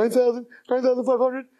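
high-pitched excited counting; mounting excitement. Acted. Man counting his windfall, thrilled. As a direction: count it up in rising disbelief.

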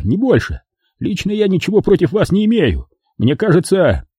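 high, nervous pleading; frightened babbling mobster. Acted. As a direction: talk fast to save your skin.

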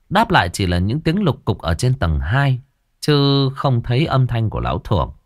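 plain reading voice

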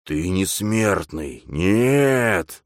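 slow, drawn-out demonic hiss; possessed medium's intense denial. A narrator's reading. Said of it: "зашипел спасённый".

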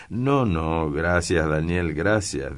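usual speaking voice

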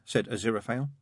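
usual speaking voice

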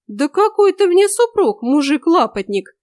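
high, loud outraged scoffing; indignant outburst. A narrator's reading. Woman rejecting her supposed husband, indignant. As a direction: throw the claim back, high and loud.

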